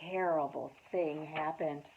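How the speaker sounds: ordinary delivery, nothing marked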